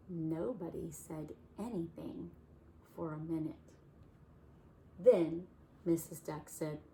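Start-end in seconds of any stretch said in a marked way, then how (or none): none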